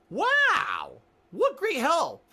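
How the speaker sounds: high, loud mock-amazed sarcasm; sarcastic wow. Sarcastic jab at the desk girl, high and loud. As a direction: drip sarcasm at the useless clerk. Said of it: "I was like".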